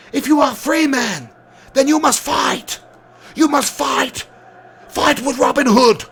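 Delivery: a light imitation of a rallying battle speech; rousing speech quote. Streamer quoting the film's rallying speech, slow and stirring. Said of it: "I love that speech where he's like".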